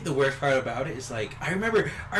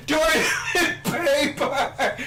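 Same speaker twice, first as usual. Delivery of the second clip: high, loud comic holler; imitated yell; 13 semitones higher, 4 dB louder, much slower than usual. Jake calling from the bathroom, high mock yell. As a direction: holler it like a desperate call from afar. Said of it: "he won't stop yelling".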